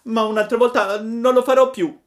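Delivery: high, loud sobbing cry. A narrator's reading. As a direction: wail it out through tears. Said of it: "gridava Pinocchio piangendo".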